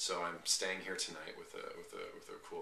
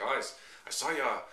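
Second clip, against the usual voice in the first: high, loud attempt at an Italian accent; badly faked Italian accent, abandoned mid-quote; 7 semitones higher, 7 dB louder than usual. Imitating the man who stopped with food, in a clumsy Italian accent. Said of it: "how to do an Italian accent".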